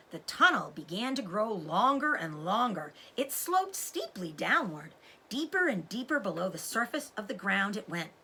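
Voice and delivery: plain reading voice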